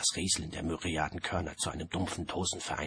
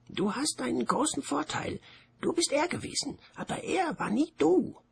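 much higher, otherworldly voice; a strange disembodied voice, pitched up high; 3 dB louder than usual. A narrator's reading. The Voice warns him about the duel, high and otherworldly. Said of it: "sagte die Stimme".

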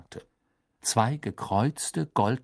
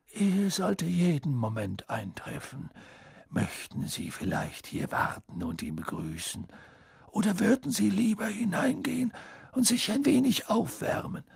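put-on polite old headmaster; 8 semitones higher than usual. A narrator's reading. Dumbledore answers politely.

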